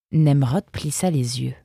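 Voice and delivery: plain reading voice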